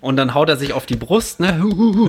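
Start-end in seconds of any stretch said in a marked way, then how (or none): 1.6-2.1 high hooting ape calls; gorilla hoots. Retold or imitated. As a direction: hoot like a chest-beating gorilla. Gorilla beating its chest. (Gorilla-Laute)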